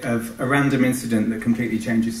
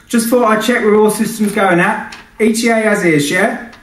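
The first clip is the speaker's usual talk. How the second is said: much higher and louder brash client shouting; shouty client voice; 10 semitones higher, 7 dB louder than usual. Acted. Client Sinatra shouts questions at the pilot, brash and pushy. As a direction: yell through the intercom, pushy. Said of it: "A shouter too, this one".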